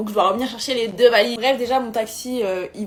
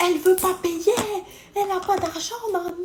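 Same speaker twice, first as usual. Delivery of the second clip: mock driver making a scene; 9 semitones higher than usual. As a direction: ham up the driver causing a scandal in public. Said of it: "il commence à faire un scandale".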